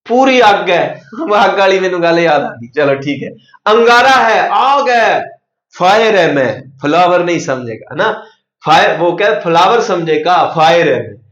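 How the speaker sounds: ordinary talk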